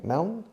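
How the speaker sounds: "Maun" as one syllable closed on N; added final N. Shown as a demonstration. Teacher repeating the built syllable for learners, added final N. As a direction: sound out "Maun", Mao with an N added. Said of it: "añades un N".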